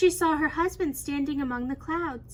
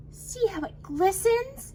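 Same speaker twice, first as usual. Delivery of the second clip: higher, wondering exclamation; exclaiming; 7 semitones higher than usual. A narrator's reading. Empress holds silk up in moonlight, exclaiming. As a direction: show it off in excited wonder. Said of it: "she exclaimed".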